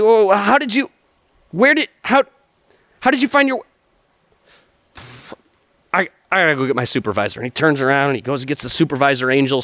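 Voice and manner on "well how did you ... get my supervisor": high, stammering guard voice; a clerk losing his script; flustered guard. Retold or imitated. Angel guard stammering at the thief, high and flustered. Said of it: "the guard's like".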